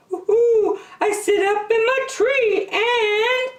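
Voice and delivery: high hooting owl voice; wise owl. Acted. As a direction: hoot like a wise old owl. Owl speaking.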